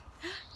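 quick high gasp; a sharp excited intake of breath; eager gasp. A narrator's reading. Guinea pig gasping at the offered carrot.

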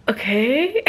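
puzzled okay with a laugh; rising, half-laughing acceptance; confused okay. Retold or imitated. Dog owner retelling her reply to a stranger, confused. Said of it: "I was just so confused".

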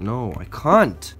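usual speaking voice

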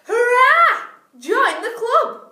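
high, loud cheer, join stretched slowly; joyful shout. A narrator's reading. Wildebeest welcoming the hyena, loud cheer. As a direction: cheer it out to the new friend. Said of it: "the wildebeest shouted".

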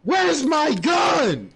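drawn-out shout, pitch up high; slow shout. Real, not acted. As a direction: yell it slow and long. Player shouting for gun in game.

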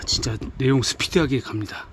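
usual speaking voice

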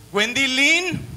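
high, mock barista name call; retold calling out. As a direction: call the wrong name out across the counter. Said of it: "empezó a gritar".

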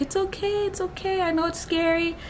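high, soft reassurance; hushed high-pitched comforting; soothing. Retold or imitated. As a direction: imitate a doctor gently soothing a patient. Doctor soothing the crying patient.

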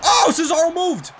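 high, loud, slow dismayed cry; surprised shout. Real, not acted. Gamer shocked his opponent dodged, high slow shout. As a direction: stretch out the disbelief.

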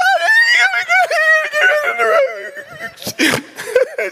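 loud squealing laughter; high shrieking laugh. Real, not acted. Pastor laughs at his roach panic, high shrieking laugh. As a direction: laugh high and hard at yourself.

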